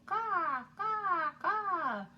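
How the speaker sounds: high, loud cawing crow. Acted. Teacher imitating a crow for the children. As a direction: caw like a bird on a wire.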